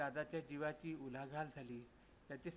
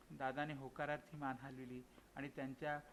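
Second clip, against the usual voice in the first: plain reading voice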